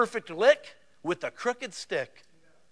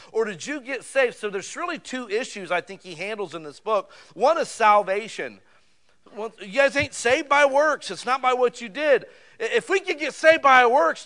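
louder, higher pulpit preaching; full-force preaching; 5 semitones higher, 4 dB louder than usual. Acted. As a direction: drive the point home to the congregation.

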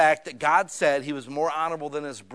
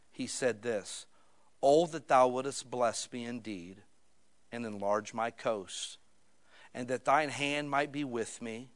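solemn scripture reading; 4 semitones lower, 6 dB quieter than usual. Acted. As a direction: read slowly and softly, with weight.

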